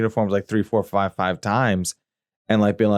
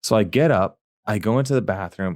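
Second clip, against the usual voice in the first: normal talking voice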